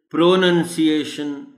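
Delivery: "प्रोनंसिएशन" with a full PRO at the front; common mispronunciation. Shown as a demonstration. Teacher listing wrong versions, "प्रोनंसिएशन" said with a full "pro". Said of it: "वगैरह वगैरह बोल जाते हैं".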